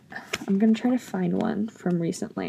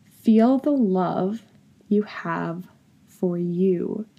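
slow, deliberate reread; much slower than usual. Shown as a demonstration. Reading her journal line to viewers, slow and tender. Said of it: "I'm gonna say that again".

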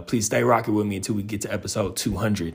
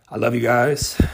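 voice cracking; 3 semitones higher, 5 dB louder than usual. Real, not acted. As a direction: voice cracks on guys. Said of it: "Well, my voice just cracked".

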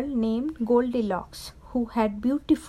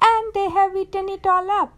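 put-on high, louder baby bear complaint; acted childlike outrage, pitched up; upset baby bear; 6 semitones higher, 4 dB louder than usual. A narrator's reading. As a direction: wail it like a little bear. Baby bear wails over empty bowl.

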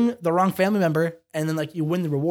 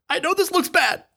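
high, soft, put-on innocent plea; mock pleading; 9 semitones higher, 4 dB quieter than usual. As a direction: pretend to beg the tribe to believe. Faking grief to the tribe.

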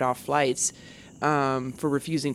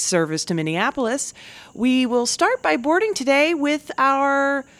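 gate agent announcement; 6 semitones higher, 4 dB louder than usual. Retold or imitated. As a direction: announce boarding over the PA. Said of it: "and they're like".

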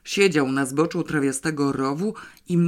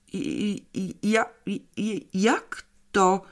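higher, halting stutter toward „jak to”; effortful stammer; 5 semitones higher than usual. A narrator's reading. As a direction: struggle to get each word out. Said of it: "powiedział z wysiłkiem". (jąkanie się z wysiłkiem)

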